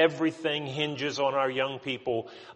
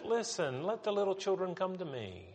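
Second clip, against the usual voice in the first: higher and much softer, tender reading; soft, gentle; 6 semitones higher, 10 dB quieter than usual. Acted. Jesus gently calling the little children to him, soft and gentle. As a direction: say it very softly, lightly lifted, soothing. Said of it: "that's the way we say it".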